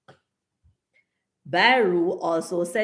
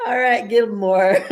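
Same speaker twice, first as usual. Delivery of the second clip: laughing; 4 semitones higher, 6 dB louder than usual. Real, not acted. Laughing after Gilmore's comment.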